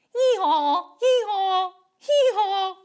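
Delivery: playful donkey hee-haw; donkey bray. Shown as a demonstration. Teacher showing children the sound a donkey makes, playful bray. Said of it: "What does a donkey say".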